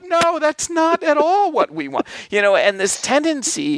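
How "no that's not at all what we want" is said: much higher, indignant squeal, stress on all; high mock-indignant complaint; outraged fan. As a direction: protest like a cheated fan. Fans rejecting the backstory.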